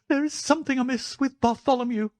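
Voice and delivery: very high-pitched, panicky little man; high, agitated character voice; frightened cry. Acted. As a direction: cry out in alarm, pitched far up. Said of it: "he cried".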